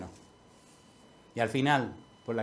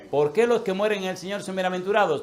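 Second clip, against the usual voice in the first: rhetorical call; 6 semitones higher, 6 dB louder than usual. Real, not acted. Preacher's rhetorical question to congregation.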